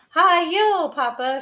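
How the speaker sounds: high, loud gleeful cry; shouting. Acted. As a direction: whoop like riding a horse. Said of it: "shouted the cubs".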